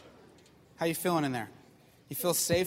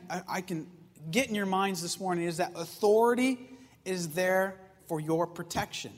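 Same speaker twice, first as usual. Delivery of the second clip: ordinary talk, nothing marked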